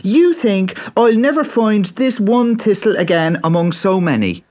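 put-on high, eager young Irish boy; bright boyish Irish lad; Pat's boy voice. A narrator's reading. Pat jeers at leprechaun.

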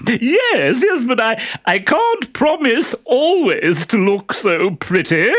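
very high, loud simpering falsetto; disguised Harlequin. Acted. Harlequin in a dress flirting with the captain, high simpering falsetto. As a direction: gush like an ugly coy bride.

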